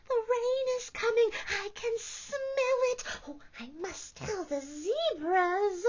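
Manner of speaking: a tiny animal spreading news; excited porcupine. Acted. Porcupine character voice.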